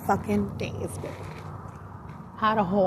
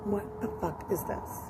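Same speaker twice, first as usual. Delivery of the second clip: hushed disbelief; 13 dB quieter than usual. Retold or imitated. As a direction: say it under your breath. Retold reaction to strange sight.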